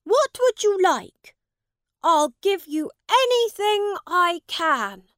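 put-on high, loud young prince voice; offering any reward royally; grand child royal offer; generous boy king. A narrator's reading. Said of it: "said Edward".

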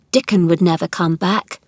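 ordinary delivery

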